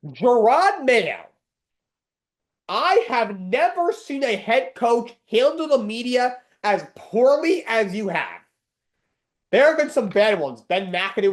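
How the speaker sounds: loud, high, slow furious call-out; angry rant. Real, not acted. Host lashing out at Jerod Mayo, loud and slow. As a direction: drag every word out in disgust. Ranting at the Patriots head coach.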